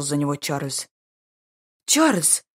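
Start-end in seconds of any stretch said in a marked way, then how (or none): 1.9-2.4 sharp high cry of his name; exclaiming. A narrator's reading. Lady exclaims at the duke's insult, a high cry. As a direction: call out his name, startled. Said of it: "воскликнула Анна".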